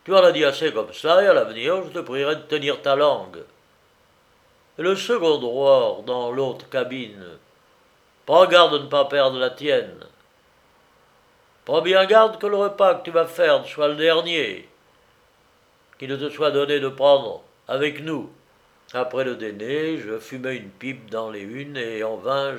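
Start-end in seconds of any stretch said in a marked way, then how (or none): 0.0-3.4 angry, loud warning; furieux. A narrator's reading. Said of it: "dit Dietz furieux". 8.2-18.3 loud, forceful threat; menace en colère. A narrator's reading. Threatening retort in the quarrel.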